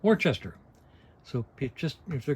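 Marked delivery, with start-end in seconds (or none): 0.0-0.6 Worcester said WOR-ches-ter with full letters, pitched up a little; Worcester sounded out as WOR-ches-ter; spelled-out Worcester. Shown as a demonstration. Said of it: "that's pronounced".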